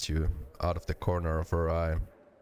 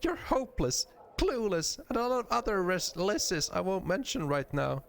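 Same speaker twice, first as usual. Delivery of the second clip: hammed-up, exasperated name-calling; mock exasperation; 13 semitones higher than usual. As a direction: play a fed-up wife in a little voice.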